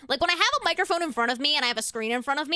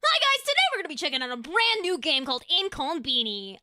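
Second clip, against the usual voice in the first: hyped streamer game intro with punched words; influencer intro; 4 semitones higher than usual. Pretend. Streamer parodying her own video opening, influencer intro. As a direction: sell the new game like an entertainment influencer. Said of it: "I just said that".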